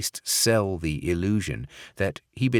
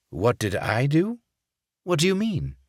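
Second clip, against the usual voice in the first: much higher fake English accent, playing innocent; fake English accent, feigned innocence; 8 semitones higher than usual. Barrett feigning innocence to Sokolov in fake English accent. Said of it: "in his fake English accent".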